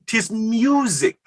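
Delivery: lifted high, slow with wonder; rapturous. Acted. To the lady, rapt and lifted high. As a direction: sing out the word music. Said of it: "do you dare mimic me".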